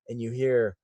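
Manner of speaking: ordinary talk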